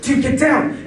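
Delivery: urgent warning. Retold or imitated. Friend warning him to get down from the car. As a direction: warn him to drop now.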